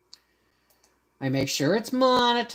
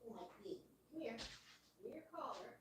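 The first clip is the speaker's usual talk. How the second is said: pet talk; 10 semitones higher, 20 dB quieter, much faster than usual. Real, not acted. Talking to her pet.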